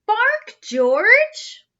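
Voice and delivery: loud high call to the dog. A narrator's reading. Mother commands dog.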